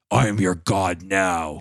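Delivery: low, slow growling god voice; bear voice. Retold or imitated. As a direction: rumble it like a bear that learned to talk. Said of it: "his voice becomes basically like ... if a bear could vocalize".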